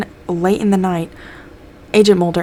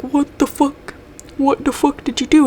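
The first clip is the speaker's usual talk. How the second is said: higher, alarmed outburst; shocked accusation; 9 semitones higher than usual. Retold or imitated. Others find Mulder over a body.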